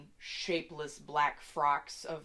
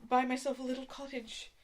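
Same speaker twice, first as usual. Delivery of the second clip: put-on high, quick, teary old woman; tearful old woman; 8 semitones higher, 3 dB louder, faster than usual. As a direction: rush it out, near tears. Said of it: "She starts wailing again".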